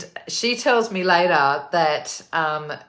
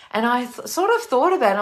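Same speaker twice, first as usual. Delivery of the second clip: rising excitement; 8 semitones higher, 4 dB louder, faster than usual. Real, not acted. Excited musing voice rises.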